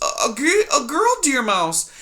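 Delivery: put-on high, small, timid mouse girl; timid young mouse. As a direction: squeak it out like a frightened little mouse. Poppy voiced in story.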